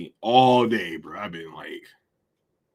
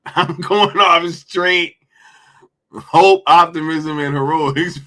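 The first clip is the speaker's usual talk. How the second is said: slow, louder, laughing delivery; laughing through it; 5 semitones higher, 6 dB louder than usual. Real, not acted. Streamer quoting the line back to chat, laughing loudly.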